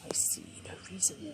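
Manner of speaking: soft unvoiced cue, barely audible; hushed prompting so only she hears; whispered prompt. Real, not acted. Mum whispering poem prompts to child.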